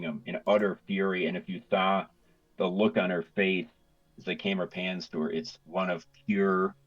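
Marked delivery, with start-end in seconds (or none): none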